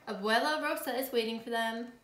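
ordinary delivery, nothing marked

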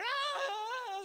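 high, drawn-out mock wail; mock wailing cry. Preacher imitating the prophets of Baal crying out, a high mock wail. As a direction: wail like a pagan prophet crying to his god. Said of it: "just crying out".

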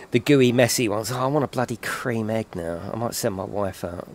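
ordinary delivery

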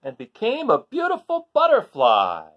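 big storybook climax; grand finale. Acted. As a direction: announce the butterfly with wonder. Story climax read aloud.

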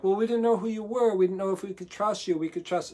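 a sailor pleading his case, a bit hammed-up; defensive protest. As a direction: whine back that you couldn't have known. Imagined crew defending themselves to Paul.

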